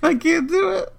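very high, squeaky protest; helpless squeal. Real, not acted. Host failing at a word, helpless high squeal. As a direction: give up in a tiny high voice.

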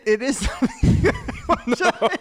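fighting back giggles; hiccup giggles. Real, not acted. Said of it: "cry laughing".

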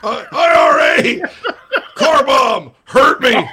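high, loud mock Irish wail; hammed-up Irish accent. Radio host keeps up the mock Irish coach, loud wailing. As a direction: shout it in an overdone Irish brogue. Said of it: "impersonate Dowell Loggins with an Irish accent".

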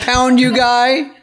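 words squeezed out mid-laugh. Real, not acted.

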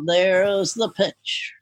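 very slow, low, stretched-out call; lazy announcer drawl. Retold or imitated. Imitating how baseball announcers call the pitch, slowly and low. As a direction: draw it out like a bored broadcaster. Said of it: "usually they go".

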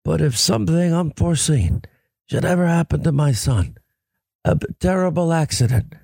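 Godfather voice, lightly done; muffled, measured mob boss; Marlon Brando impression. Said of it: "you like my Marlon Brando".

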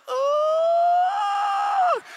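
long, high, loud boo-hoo howl; mock crying wail. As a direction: wail like a bawling schoolboy, hammed up. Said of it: "I just started crying. I was like".

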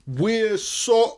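slow, high, hammed-up tearful apology; mock blubbering. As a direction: fake a sob through the apology. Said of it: "blubs [name]".

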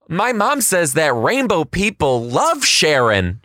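put-on gasp, then a high, slow, excited child voice; breathless kid announcing big news; imitating a little girl. Teacher imitating his student's gasp and excited line. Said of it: "she says".

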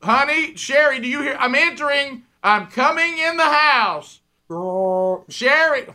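high, loud sing-song announcement; loud announcing. Retold or imitated. Husband warning his wife he is coming in the house. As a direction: announce yourself so she won't scream. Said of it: "I'm going".

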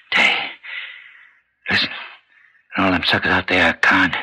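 normal talking voice

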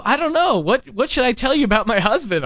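high, unsure woman's voice; lady voice. Retold or imitated. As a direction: voice a clueless wife. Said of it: "She's like".

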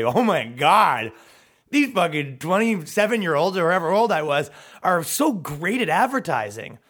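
put-on awestruck client voice; mock amazement. Clients impressed by ten thousand shares, mock awe.

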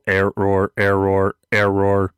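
stuck robot repeating error; malfunctioning robot voice; glitching robot. Pretend. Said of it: "Robo Dick Monfort".

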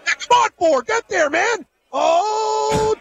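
very high, desperate cheering; will the horse to the line; yelling at a horse race. Real, not acted. Screaming for his horse.

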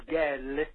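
put-on "gel" said slowly, as the Sicilian mother's way of saying girl; mother's accent. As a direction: stretch out "gel" the way mama says girl. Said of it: "Girl was gel".

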